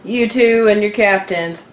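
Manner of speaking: normal talking voice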